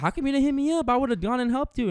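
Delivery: a high mock aunt, stung he didn't call; aunts' high voice. Relative scolding nephew for not asking help, in a high mocking voice. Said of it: "they were like".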